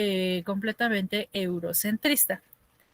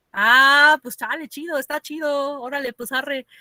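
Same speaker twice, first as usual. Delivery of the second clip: a relaxed chilango slang reply; chilango street accent; 4 semitones higher, 5 dB louder than usual. Retold or imitated. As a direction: answer like a laid-back kid from Tepito. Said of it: "la gente con este acento". (acento chilango relajado)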